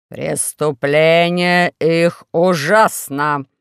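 low, very slow solemn verdict; Four-Eyes. Acted. Dictator condemning the strangers, slow and grave. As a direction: pronounce it gravely and slowly. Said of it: "сказал Четырёхглазый".

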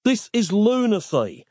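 high, exasperated snap; snapped. A narrator's reading. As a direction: snap it out, at the end of patience. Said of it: "snapped Nick".

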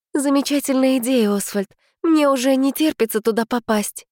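strangled forced cheer; strained voice. Acted. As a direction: squeeze the words out through tension. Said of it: "сдавленным голосом говорю". (сдавленным голосом)

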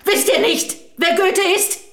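high, frantic scolding yell; retold scolding scream. Wife retelling how she screamed at armed soldiers, high frantic yell. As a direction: shriek at the soldiers to save him. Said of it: "Ich habe gezetert, gefuchtelt, geschrien".

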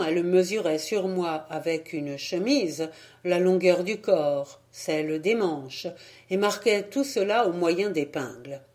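plain reading voice

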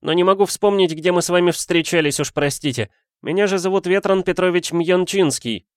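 high-pitched student introducing himself; student poet. A narrator's reading. Student poet introduces himself to the detective, high-pitched and formal. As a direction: voice the young poet well above narration.

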